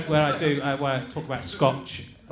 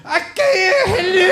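very high, exaggerated Scottish caricature; mock Scottish accent; 13 semitones higher, 6 dB louder, slower than usual. Shown as a demonstration. Host winding up his Scottish guest, mock Scottish accent. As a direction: do a hammed-up, high-pitched bad Scottish accent. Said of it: "This is my Scottish accent".

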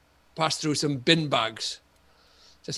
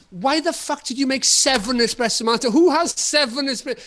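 higher, exasperated outburst at the friend; retold anger; 9 semitones higher, 3 dB louder than usual. Recalling ranting at the friend over seven martinis, exasperated. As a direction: replay the indignant rant at him. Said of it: "because I was like".

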